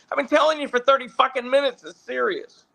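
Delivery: high, fast, fed-up woman; exasperated producer. Retold or imitated. Producer repeating herself, high and fast. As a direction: rattle it off, exasperated. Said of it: "she's like".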